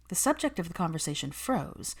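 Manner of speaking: ordinary delivery, nothing marked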